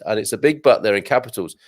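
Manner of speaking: usual speaking voice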